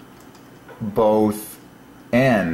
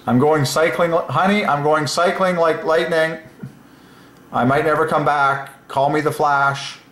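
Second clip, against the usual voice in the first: higher, excited heroic boasting; hammed-up husband telling his wife he's off; playful husband; 7 semitones higher than usual. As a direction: brag like a superhero leaving the house.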